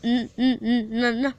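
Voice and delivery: stuck stammering on the first sound; halting, repeated-consonant stammer; stuttering. Acted. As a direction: stutter the N over and over, unable to get the name out. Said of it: "Hinata stutters".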